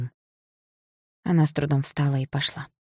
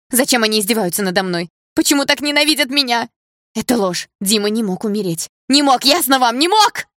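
high, loud, desperate outburst; hysterical denial; 8 semitones higher, 7 dB louder than usual. A narrator's reading. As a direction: let the refusal build to a piercing cry. Distraught girl refuses the news.

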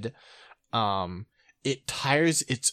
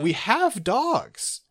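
sarcastic; 8 semitones higher, much slower than usual. Retold or imitated. Mocking "we have X at home" meme.

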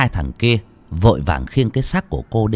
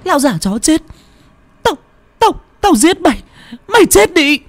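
put-on shrill high enraged scream at the old man; 5 dB louder than usual. A narrator's reading. Lành screaming at the old man, high and furious. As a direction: scream it with murderous rage. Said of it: "miệng la hét".

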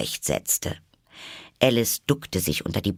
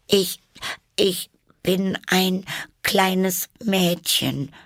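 put-on high, slow and hesitant girl; not convinced of it herself; high girl's voice, faltering; unsure Alice; 12 semitones higher, much slower than usual. A narrator's reading. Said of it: "sagte Alice etwas unsicher".

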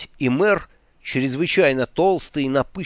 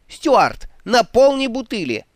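high, loud barked command; shouting order; 11 semitones higher, 5 dB louder than usual. Acted. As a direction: call across the tavern. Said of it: "выкрикнул Флинт".

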